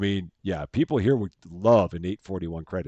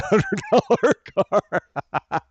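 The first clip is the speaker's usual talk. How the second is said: breaking into laughter; 10 semitones higher, 4 dB louder, much slower than usual. Real, not acted. Streamer recalling an old card shop video, laughing. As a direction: voice climbing into a laugh.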